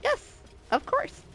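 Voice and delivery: high, bright yes-man voice; high eager reply. Retold or imitated. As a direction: chirp it back eagerly. Quotes own reply.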